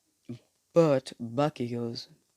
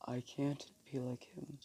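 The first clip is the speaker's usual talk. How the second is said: hushed, barely voiced despair; deep sorrow; 14 dB quieter than usual. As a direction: say it very softly, a touch of sorrow. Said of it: "Crying in deep sorrow".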